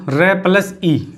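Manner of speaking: र plus ई spoken slowly, each sound separated; sounds pulled apart. Shown as a demonstration. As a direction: slowly split री into र and ई. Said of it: "इसमें र की sound". (र और ई को धीरे-धीरे अलग करके बोलना)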